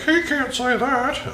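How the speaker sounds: high, drawn-out silly voice; mock scolding YouTube. YouTube objecting to something stupid he said, high mock voice. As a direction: squeal it slowly as a mocking objection. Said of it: "YouTube's gonna be like".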